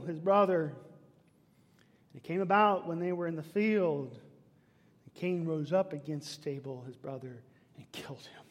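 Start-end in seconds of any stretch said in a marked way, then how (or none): 5.0-8.4 dropping to a whisper for the killing; grave hush over the killing; hushed narration. A narrator's reading. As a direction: let the murder fade into a whisper. Telling of the murder.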